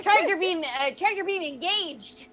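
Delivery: high-pitched in-character system callout; acted starship announcement; announcing a ship command. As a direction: call it out high from the console. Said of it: "I go".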